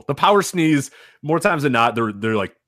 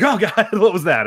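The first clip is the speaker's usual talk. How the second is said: laughing surprise; 6 semitones higher, 3 dB louder than usual. Retold or imitated.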